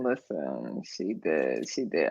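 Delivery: dropped very low, mock serious; deep voice. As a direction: go way down low and gruff.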